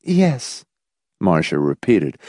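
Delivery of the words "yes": high, small, automatic reply; mechanical response. A narrator's reading. Marcia answers the schoolteacher tone, mechanically. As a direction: answer obediently, without investment. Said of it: "mechanically responding to the dry, dispassionate school teacher tone".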